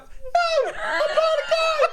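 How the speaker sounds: very high, loud, drawn-out squeal; mock whiny yell, laughing; exaggerated refusal. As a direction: shriek it like a hammed-up petulant gamer. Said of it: "I don't say that".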